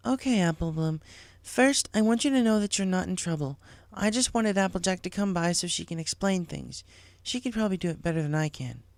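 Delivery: calm a worried student; lightly voiced reassuring teacher. Cheerilee reassuring Apple Bloom, lightly voiced kind teacher.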